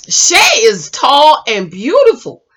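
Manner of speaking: ordinary delivery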